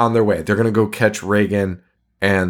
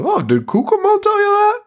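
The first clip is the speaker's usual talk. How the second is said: much higher, gushing mock-cheerful voice; imitating an eager, delighted teen; enthusiastic and happy. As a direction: ham up a bubbly excited girl. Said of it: "so enthusiastic and happy".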